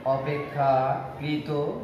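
অপেক্ষাকৃত said very slowly, syllable by syllable; slow spelling out. Shown as a demonstration. Teacher dictating a word to students, very slowly. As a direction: stretch অপেক্ষাকৃত out for the class.

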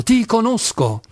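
high, angry repeated accusation; irate. A narrator's reading. Enraged clerk accuses Kolia in the street, irate. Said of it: "esclamava in tono iroso".

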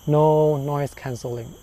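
ordinary talk, nothing marked

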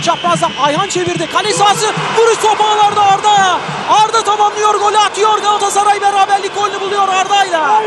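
loud, rising goal call; screaming football commentator. Real, not acted. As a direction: shout the goal with rising excitement.

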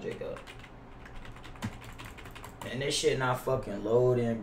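normal talking voice

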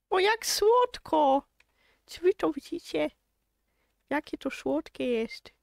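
high, slow cooing over something cute. Real, not acted. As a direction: melt over the cute foal. Cute foal with mother. (rozczulone, wysokie gruchanie)

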